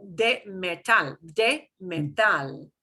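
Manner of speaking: slow de metal with TAL punched; slow stress demo. Shown as a demonstration. Teacher drilling phrase for class, slow de metal stressed at the end. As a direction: drag out de metal, hit the end. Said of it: "we punch the end there".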